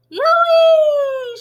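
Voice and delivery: very high, very loud whooping scream. Acted. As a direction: shriek it out with wild delight. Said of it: "she screamed".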